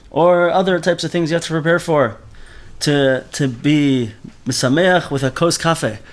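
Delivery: normal talking voice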